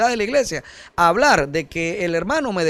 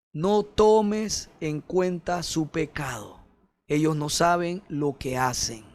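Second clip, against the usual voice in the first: softer, slow and gentle; tender forgiveness; 2 semitones lower, 5 dB quieter, slower than usual. Acted. Christ on the cross forgiving his killers, soft and slow. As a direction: quietly plead for mercy.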